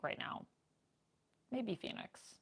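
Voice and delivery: ordinary delivery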